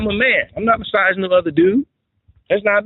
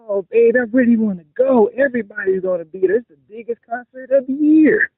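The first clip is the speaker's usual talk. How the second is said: begging wife; 8 semitones higher than usual. Retold or imitated.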